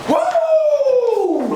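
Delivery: loud, high, drawn-out "woo!"; celebratory whoop. Retold or imitated. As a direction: whoop it out like the winning catch just happened. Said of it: "in the middle of the street screaming".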